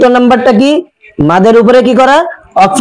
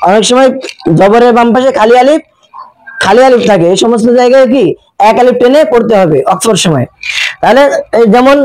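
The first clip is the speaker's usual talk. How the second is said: normal talking voice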